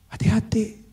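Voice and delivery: ordinary talk, nothing marked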